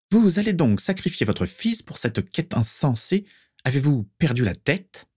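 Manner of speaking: high-pitched scolding; Prisca. A narrator's reading. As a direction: remonstrate in a perched voice. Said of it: "de sa voix haut perchée". (remontrance d'une voix haut perchée)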